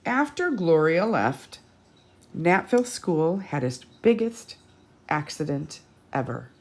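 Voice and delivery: usual speaking voice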